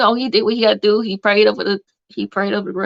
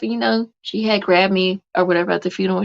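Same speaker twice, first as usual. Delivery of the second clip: ordinary talk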